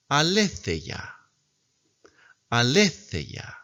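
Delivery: Aletheia said slowly with falling end, th as in think; teacher models the word for repeating; slow model reading. Shown as a demonstration. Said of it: "Repita siempre conmigo".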